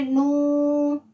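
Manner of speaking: नू held long and slow; two-count madd. Shown as a demonstration. Teacher demonstrating the two-second madd on नू. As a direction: stretch the vowel of नू for two counts. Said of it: "two seconds के लिए खींचेंगे नू".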